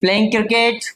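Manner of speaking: slow, careful English answer read out for students; slow model answer. Shown as a demonstration. Teacher spelling out a model answer slowly for students. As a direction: dictate each word slowly. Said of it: "इस तरह से आप बोल सकते हैं".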